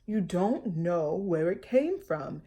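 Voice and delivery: father warning his daughter; lightly voiced cautious father; father's voice. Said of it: "her father said".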